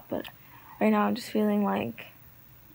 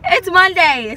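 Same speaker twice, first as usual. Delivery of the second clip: loud announcement; 8 semitones higher, 13 dB louder, slower than usual. Acted. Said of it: "So it's Monday".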